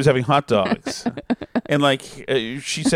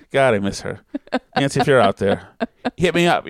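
ordinary talk

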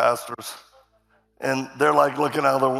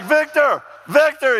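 high, loud shout of a name; retold yelling; 12 semitones higher, 7 dB louder, slower than usual. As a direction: imitate an alarmed colleague yelling for someone. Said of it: "he's yelling".

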